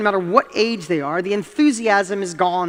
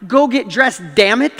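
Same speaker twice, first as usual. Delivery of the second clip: retold rising anger; 3 semitones higher, 5 dB louder than usual. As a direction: re-enact losing patience, punching it out louder. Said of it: "I was starting to get mad".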